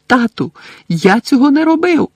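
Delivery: high, loud shouted plea; desperate cry. A narrator's reading. Chained son crying out to his father, desperate. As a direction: cry out your innocence. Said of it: "крикнув хлопець".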